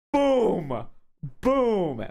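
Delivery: loud, high shouted boom; hammer-blow boom. Acted. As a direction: punch out the impact, falling in pitch. Imitates two blows to head.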